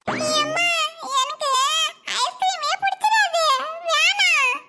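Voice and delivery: put-on high-pitched childish refusal; whiny child. Child telling mother no ice cream, whining. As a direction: protest like a fussy little kid.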